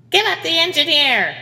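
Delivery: high, loud badger bounty hunter; exclaimed. Badger demands the engineer from the ship, loud exclamation. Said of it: "the badger exclaimed".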